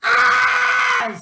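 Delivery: high, loud held scream; demo scream. Shown as a demonstration. Showing viewers how to scream while peeing, high and loud. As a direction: scream it through the pain. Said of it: "just screaming while you're peeing".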